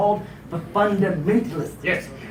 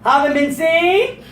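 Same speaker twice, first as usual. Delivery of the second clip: high, slow, drawn-out roll call; mock public name-calling, rising at the end; stewardess calling names; 8 semitones higher, much slower than usual. Stewardess calls names off list.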